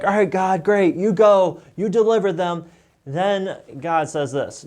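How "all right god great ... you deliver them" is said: higher, mock-eager brush-off; hammed-up reluctant Moses; sarcastic Moses. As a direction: toss it back at God, pretending to be keen. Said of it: "Moses at this point is probably like".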